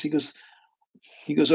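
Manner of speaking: usual speaking voice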